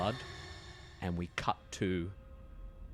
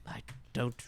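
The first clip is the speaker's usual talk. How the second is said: flustered detective; 7 semitones higher, 7 dB louder than usual. Acted.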